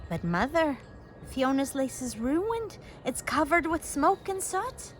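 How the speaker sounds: ordinary delivery, nothing marked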